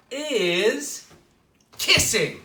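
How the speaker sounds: high, loud punch on kissing; big reveal. Acted. Telling children the monsters' weakness, a loud high reveal. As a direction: land the punchline.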